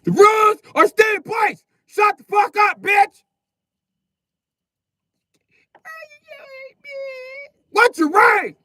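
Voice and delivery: high, loud barked officer shout; furious officer shout. Acted. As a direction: blast it at the complainer like a colonel. Leo officer yelling back.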